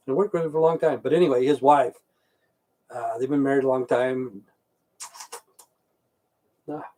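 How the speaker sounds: usual speaking voice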